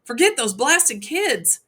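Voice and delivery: high, loud frantic yell from below the fire; screaming. A narrator's reading. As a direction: scream up at him to get out now. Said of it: "he screamed".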